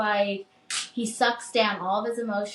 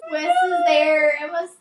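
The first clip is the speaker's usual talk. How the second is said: excited squeal; 9 semitones higher, 8 dB louder, faster than usual. Real, not acted. Excited fan gushing about scene.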